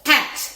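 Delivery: "Cat" as one high, loud beat; one clapped syllable. Shown as a demonstration. Teacher clapping out one syllable, one-beat Cat. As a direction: hit "Cat" like a single clap. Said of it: "If you clap, you only hear".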